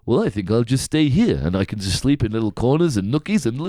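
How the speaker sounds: rough, put-on Eastern European accent; bad Eastern European accent. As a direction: imitate a film character with a generic Eastern European accent. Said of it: "He played an Eastern European guy".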